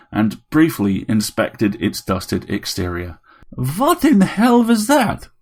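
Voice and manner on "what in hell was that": high, drawn-out vampire robot outcry; indignant vampire robot. A narrator's reading. Robot dusted with garlic powder reacts, indignant. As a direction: draw it out slowly in a high vampire voice.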